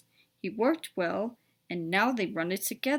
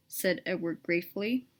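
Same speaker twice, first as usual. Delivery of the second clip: usual speaking voice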